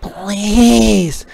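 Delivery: high pleading "Please"; begging. A narrator's reading. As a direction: beg the frog sweetly. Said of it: "begged the spider".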